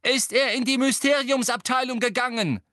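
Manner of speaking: loud, high-pitched demand; urgent call. A narrator's reading. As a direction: shout after the elf for an answer. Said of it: "rief Harry dem Elfen nach".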